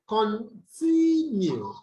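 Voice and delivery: "continue" with a long, slow "tin" in the middle; stress on second syllable. Shown as a demonstration. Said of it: "you have the stress on the second syllable".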